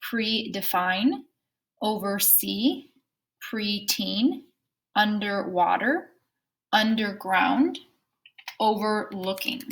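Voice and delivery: slow, clear words for the class to echo; careful classroom dictation with a rising end; repeat-after-me drill. Shown as a demonstration. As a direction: say each word slowly so kids can repeat. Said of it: "repeat after me".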